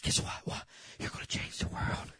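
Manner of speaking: put-on whispered secret to a child; barely audible, whisper it close; a father's whispered promise, acted out; hushed confiding.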